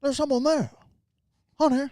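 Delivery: high, loud imitation of Jimmy Stewart calling out; mock movie husband. Friend replays Rear Window scene, mock husband calling. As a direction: call out as a jumpy movie hero.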